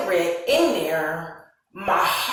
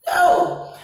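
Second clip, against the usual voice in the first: emphatic no; 11 semitones higher, 6 dB louder than usual. Real, not acted. Firm refusal of being hit.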